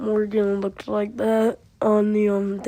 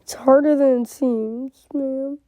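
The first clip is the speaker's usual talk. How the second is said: higher, louder, exasperated; 5 semitones higher, 3 dB louder than usual. Shown as a demonstration. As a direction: complain it with more push. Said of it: "repeated line, raised".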